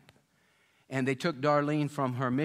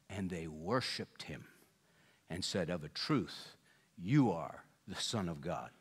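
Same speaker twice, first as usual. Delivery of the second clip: reverent hush; 7 semitones lower, 6 dB quieter than usual. Acted. Bible quote read reverently.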